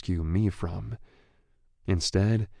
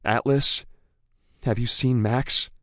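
put-on higher, anxious young woman; 5 semitones higher, 5 dB louder than usual. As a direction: call out for a friend. Female character calling out.

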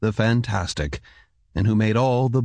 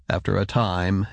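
plain reading voice

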